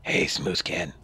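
low rasping ghoul greeting. Acted. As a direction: rasp it like a throat infection. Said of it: "It's not that hard to actually do a ghoul voice".

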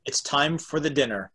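ordinary delivery

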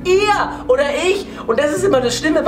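exclaiming. Real, not acted. Exclaiming the stakes.

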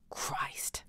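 an unvoiced, hushed swear; whispered curse. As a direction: breathe it out as a whisper. Pretends to find drawer empty.

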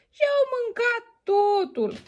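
a cub upset that it's all gone; baby bear. Acted. Baby bear finds his bowl empty, high upset cub voice. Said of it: "strigă cu vocea scăzută copilul".

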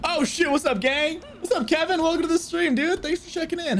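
high-pitched hyped welcome; pleasantly surprised, pitched well up; excited greeting. Real, not acted. Streamer greets viewer joining the stream.

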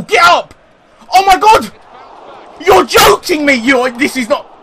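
high, loud yell at the miss; disbelieving shout. Real, not acted. Late chance, disbelief.

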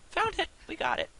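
sudden high excited cry; excited discovery. Real, not acted. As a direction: burst out with the find. Discovers the route.

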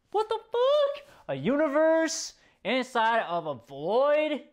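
real jaw-drop at a universe appearing; high-pitched what-the-fuck of pure shock; stunned disbelief. Real, not acted. Stunned by the anime scene.

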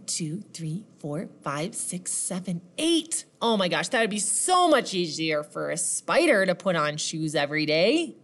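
usual speaking voice

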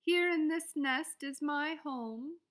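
proud little robin. A narrator's reading. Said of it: "sang the robin".

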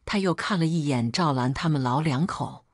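plain reading voice

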